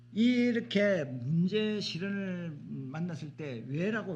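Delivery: usual speaking voice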